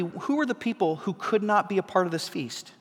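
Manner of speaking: ordinary talk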